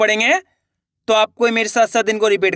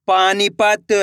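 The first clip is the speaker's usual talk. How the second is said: slow, stretched reading of the whole word; joined-up word; much slower than usual. Shown as a demonstration. Teacher showing learners the finished word, slowly joined up. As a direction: blend the syllables into one drawn-out word. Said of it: "तो मिलके बन जाएगा".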